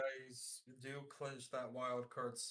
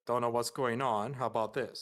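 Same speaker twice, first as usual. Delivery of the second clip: much louder, blasting the mic; suddenly much louder into the mic; 15 dB louder than usual. Real, not acted. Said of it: "you're loud".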